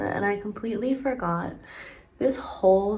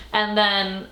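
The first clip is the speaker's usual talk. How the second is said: normal talking voice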